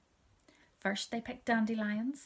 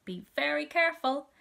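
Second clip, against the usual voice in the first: Mister Coco's caution; 6 semitones higher, 7 dB louder than usual. A narrator's reading. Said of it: "said Mister Coco Jones".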